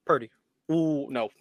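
ordinary delivery

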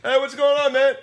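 goofy high voice. Pretend. Greeting Pete in a voice.

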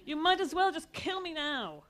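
loud, high despair; dramatic lament. A narrator's reading. Mother wailing at her family, loud and high. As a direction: throw up your hands in despair.

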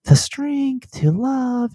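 high, slow, sugary little prayer; meek prayer voice. Retold or imitated. Mocking her own gentle prayer to God, high and slow. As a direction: pray like a sweet timid child, drawing it out. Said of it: "I'm always like".

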